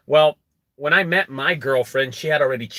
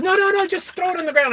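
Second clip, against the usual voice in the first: much higher, frantic imitation of her; imitating her alarm; 4 dB louder, faster than usual. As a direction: squeal it like she can't bear to touch it. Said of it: "She goes".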